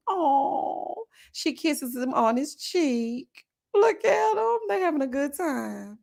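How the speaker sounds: drawn-out, high-pitched adoring coo; gushing coo. Acted. Telling of a kiss on the cheek, gushing. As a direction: coo over them as if watching a cute couple. Cooing over the couple's kiss.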